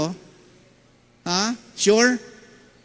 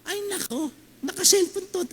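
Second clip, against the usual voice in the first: very high mock-old aunt; imitating a gossipy tita; 13 semitones higher than usual. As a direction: squeak like a fussy tita.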